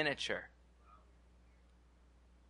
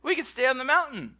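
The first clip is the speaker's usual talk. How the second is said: higher, louder eager Peter, slowing on the tents; excited Peter; 8 semitones higher, 5 dB louder than usual. Acted. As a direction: play Peter blurting out his plan. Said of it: "oh, here comes Peter".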